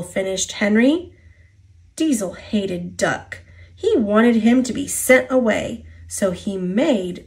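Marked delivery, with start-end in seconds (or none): none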